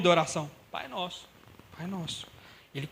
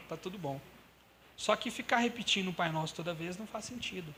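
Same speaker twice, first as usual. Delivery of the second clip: normal talking voice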